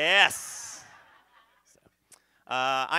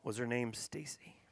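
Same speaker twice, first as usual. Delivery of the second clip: sheepish question; 4 semitones lower, 8 dB quieter, slower than usual. Acted. Guilty husband asks quietly.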